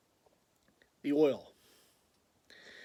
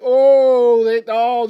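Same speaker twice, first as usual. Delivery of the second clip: excited outburst; 5 semitones higher, 11 dB louder, much slower than usual. Retold or imitated. Seeing the perfect hat.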